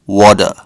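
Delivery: water with a flapped t and r, wadder; American pronunciation. Shown as a demonstration. Teaching the US way of saying water, American pron. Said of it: "Americans will call this".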